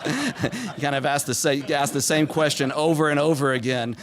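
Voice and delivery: laughing at his own joke; laughing while talking. Real, not acted. To the audience about a persistent toddler, laughing. Joking about repeated why questions.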